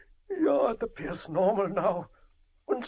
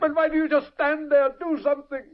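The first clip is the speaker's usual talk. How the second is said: higher, louder, frantic demand; desperate shout; 6 semitones higher, 5 dB louder than usual. Acted. Father screams at the scientist to save his son. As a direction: yell at him to act, panicking.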